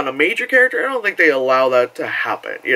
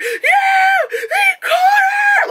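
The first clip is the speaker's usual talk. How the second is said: high shrieking cheer; excited screaming; much slower than usual. Retold or imitated. Teens in the back screaming with joy, high shriek. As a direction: shriek with delight. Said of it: "they were screaming".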